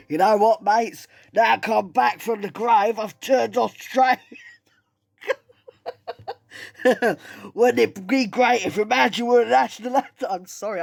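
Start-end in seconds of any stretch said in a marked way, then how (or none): 0.1-4.2 hammed-up Australian accent, loud and high; deliberately botched Liverpudlian turned broad Australian. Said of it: "came out Australian". 5.7-7.5 high-pitched laugh; real laugh. Real, not acted. Cracks up at own impression. 7.5-10.3 bad Australian accent, loud and high; mock-Australian accent pushed over the top. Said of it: "I can't do an Australian accent".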